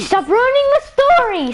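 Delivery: high, exasperated complaint; exasperated outburst. Real, not acted. Storyteller telling off friends who interrupt, exasperated. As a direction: cut off the interrupters, voice jumping up.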